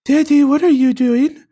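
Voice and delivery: playing his four-year-old asking dad, in a high child voice; little kid voice. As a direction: squeak it like a small daughter. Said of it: "I just can't get over your voice".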